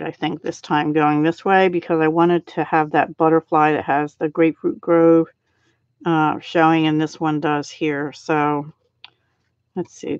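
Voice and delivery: ordinary delivery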